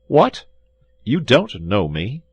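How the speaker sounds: astonished threat to the innkeeper; indignant surprise. Acted. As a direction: shoot up in outrage, then press slowly. D'Artagnan confronts treacherous host.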